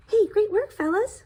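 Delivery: classmate cheer. Acted. Classmates call out praise.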